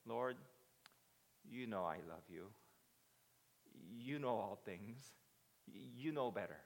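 subdued, halting humility; meek, measured admission; humble reply. Acted.

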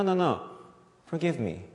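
normal talking voice

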